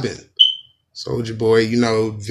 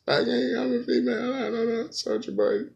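high, soft hammed-up whimper; mock crying; 10 semitones higher, 7 dB quieter than usual. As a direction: whine it like fake tears. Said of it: "I'm crying".

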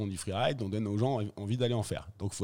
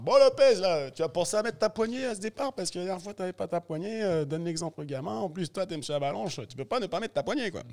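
mock stern organizer; 7 semitones higher than usual. Event organizer teasing the rider about his airbag handle, mock stern. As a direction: lecture him like a bossy organizer, high-pitched. Organizer voiced lecturing him.

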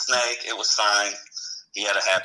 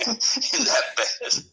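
breathy laugh through the words, slow; much slower than usual. Real, not acted. Fireman ending his prank story, laughing the words out.

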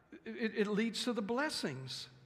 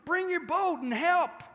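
calling out; 9 semitones higher, 7 dB louder, slower than usual. Acted. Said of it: "He's signaling for his partners".

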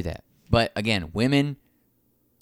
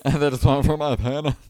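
higher, louder mock-wounded echo; 5 semitones higher, 5 dB louder than usual. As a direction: parrot the friend's worry mockingly. Mockingly echoes co-host's line.

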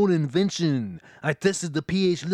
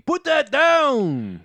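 urgent warning; 8 semitones higher, 5 dB louder, much slower than usual. Acted.

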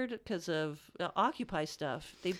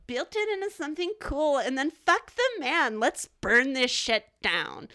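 mocking voice; 5 semitones higher, 5 dB louder than usual. Mocking the burners' attitude.

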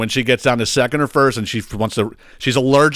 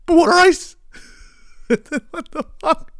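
incredulous; slower than usual. Real, not acted. Incredulous ranting about phrase.

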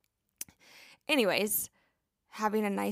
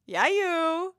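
louder, higher Hawaiian pidgin hype call; pidgin slang; 6 semitones higher, 8 dB louder, much slower than usual. Retold or imitated. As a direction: cheer a friend on in Hawaiian pidgin. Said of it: "I'm always saying".

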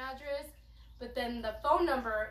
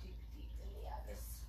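spooky whisper; 10 dB quieter than usual. Pretend. Rummaging through tea box.

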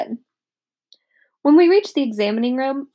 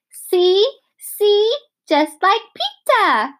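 put-on high, louder proud toddler; 11 semitones higher, 5 dB louder, much slower than usual. A narrator's reading. Fudge boasting to the dentist, high and proud. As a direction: boast it like a proud little kid.

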